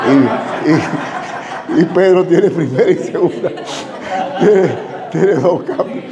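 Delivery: chuckling through the punchline; laughing at own joke. Real, not acted. Preacher laughing through his car joke. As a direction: laugh as you deliver the gag.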